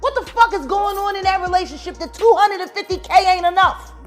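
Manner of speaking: loud, high incredulous outburst; outraged disbelief. Real, not acted. Heated rant over money.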